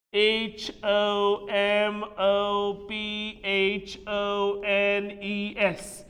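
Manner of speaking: letter by letter, each one drawn out; slow spelling. Shown as a demonstration. Teacher spells the term for students, slow drawn-out letters. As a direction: spell it very slowly and evenly.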